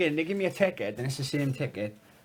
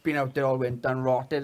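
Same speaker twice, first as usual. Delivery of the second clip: normal talking voice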